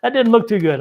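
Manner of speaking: laughing out, high and loud. Real, not acted. Reacting to a fierce tarot card, bursting into laughter. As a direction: laugh through the words at the card.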